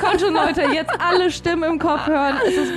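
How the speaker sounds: high, laughing hype call; rally everyone with a laugh; hyped up. Real, not acted. Hyping podcast to listeners.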